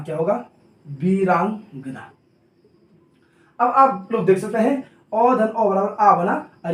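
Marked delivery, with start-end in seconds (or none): 0.9-2.1 "Veerangana" drawn out slowly in pieces; syllable-by-syllable. Shown as a demonstration. Teacher showing students the joined word, "Veerangana" slow and stretched. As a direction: sound out "Veerangana" slowly, split before the ending.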